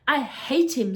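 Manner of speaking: loud, heated outburst; hotly. Acted. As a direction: spit it out in anger. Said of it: "said Joe hotly".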